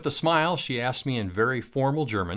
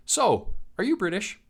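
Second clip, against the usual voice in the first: lightly voiced old lady in a Midwestern American accent, pitched higher; a hint of a sweet Midwestern American granny asking; grandma in Midwestern American accent; 10 semitones higher than usual. German grandma on the train asks in Midwestern American accent. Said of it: "with an absolutely spot-on Midwestern American accent".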